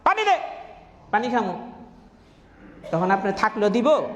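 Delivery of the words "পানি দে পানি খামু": much higher, rude ordering-about voice of an ill-mannered man; rude lout demanding water. Retold or imitated. Preacher imitating a rude man ordering water, hammed-up rudeness. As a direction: play the boor who orders a stranger to fetch water. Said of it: "একটা বদমাশ অসভ্য কোত্থেকে আসছে".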